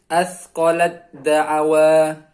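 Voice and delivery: "Asqalat da'awa" slow and loud, as asqalad-da'awa; ta merged into dal. Shown as a demonstration. Teacher models ta vanishing into dal, slow loud recitation. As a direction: turn the t of "Asqalat" into a doubled d. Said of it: "sa-nya hilang".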